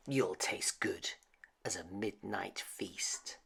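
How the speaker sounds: hushed, hungry threat; menacing whisper. Acted. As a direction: whisper it like a predator savoring. Child threatens the mouse.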